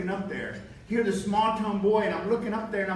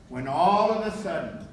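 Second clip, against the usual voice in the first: ordinary talk, nothing marked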